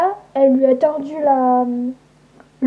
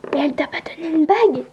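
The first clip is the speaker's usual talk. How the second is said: question chuchotée; faster than usual. Acted.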